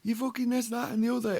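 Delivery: high-pitched mock ranting; mocking high-voiced imitation of an outraged stranger; imitating hysterical woman. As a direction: put on a shrill, screechy complainer's voice. Mimics the screaming woman's rant.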